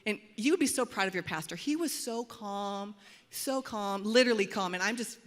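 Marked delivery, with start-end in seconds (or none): none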